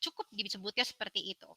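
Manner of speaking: ordinary talk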